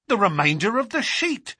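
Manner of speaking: high, eager exclamation; excited cry. Acted. Inspector sees the missing paper, excited cry. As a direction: cry out in discovery. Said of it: "cried the inspector".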